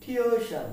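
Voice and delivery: টিউশন as thio-e-shan; correct pronunciation. Shown as a demonstration. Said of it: "আবার খেয়াল করি".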